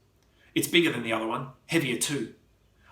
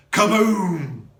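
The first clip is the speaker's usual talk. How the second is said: explosion; 6 semitones higher, 8 dB louder than usual. A narrator's reading. Explosion sound effect.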